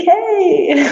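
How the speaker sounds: high, loud horse whinny shaped as "hey"; imitated whinny. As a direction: neigh a greeting like a happy horse. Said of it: "he would just whinny".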